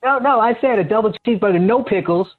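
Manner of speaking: calls out a burger order at the speaker box; ordering at drive through. Pretend. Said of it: "I thought I was supposed to be ordering at the drive-thru".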